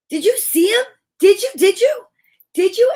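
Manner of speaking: high excited repeated asking; eager questions. Acted. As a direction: bounce with eagerness. Peter asks eagerly.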